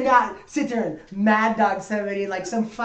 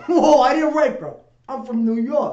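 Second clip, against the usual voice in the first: mock New Yorker; 3 semitones higher, 6 dB louder than usual.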